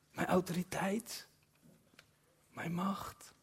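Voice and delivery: softly whispered, slow; whispered promise. Acted. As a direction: whisper the promise close to the ear. Voices Jesus's promise intimately. (zacht gefluisterde belofte)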